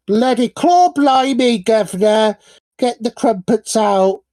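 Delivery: exaggerated mock British accent, sent up for laughs; bad British impression. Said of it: "my impression is awful".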